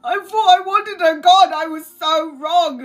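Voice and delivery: high, teary and choked up; half-laughing through real tears; crying. Real, not acted. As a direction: voice cracking with tears. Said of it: "I'm really emotional".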